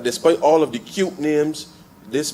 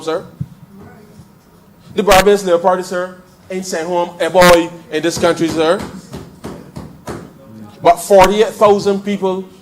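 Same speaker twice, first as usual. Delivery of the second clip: louder, driving debate speech; fiery parliamentary oratory; 4 semitones higher, 7 dB louder than usual. Real, not acted. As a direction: hammer the point home to the House. MP rises to full parliamentary oratory.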